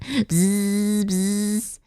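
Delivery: drawn-out imitated insect buzzing; playful long bzz, bzz; mosquito buzz. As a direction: buzz around like a little mosquito. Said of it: "I look like a muzzy buzz buzzing around".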